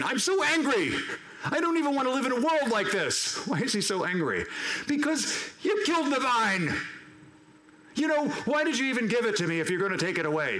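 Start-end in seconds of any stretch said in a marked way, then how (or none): 0.0-3.5 high-pitched, hammed-up tantrum; acted anger, high and put-on; Jonah's angry whine. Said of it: "Jonah's angry again". 4.9-10.6 high-pitched sulky protest, then quicker; petulant whine, speeding up as it eases down; Jonah being petulant. Acted. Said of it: "Jonah's being petulant".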